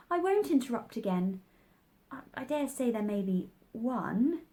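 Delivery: usual speaking voice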